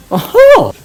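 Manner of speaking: sharp high yelp of surprise; startled as she dodges; surprised oh. Acted. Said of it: "dodging her swing".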